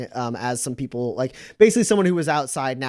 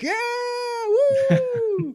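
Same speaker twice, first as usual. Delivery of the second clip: high, whooping cheer; crowd cheer. Acted. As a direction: cheer the free drinks. Bar crowd cheers free round.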